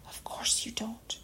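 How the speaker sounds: soft, hushed reassurance; a soft, comforting whisper. A narrator's reading. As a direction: whisper it to soothe a frightened friend. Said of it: "in a comfortable, comforting voice".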